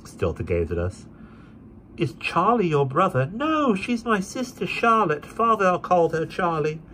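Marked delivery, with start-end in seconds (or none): 2.0-3.3 put-on higher gentle young woman; young lady. A narrator's reading. Esther questions the boy. 3.3-6.8 put-on high, slow little boy voice; small boy. A narrator's reading. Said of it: "said the boy".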